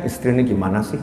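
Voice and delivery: soft, low complaint to oneself; inner grumbling. Acted. Scolded husband thinking to himself about his wife, hushed grumbling. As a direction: imitate a weary husband's silent thoughts. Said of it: "di benaknya, di hatinya".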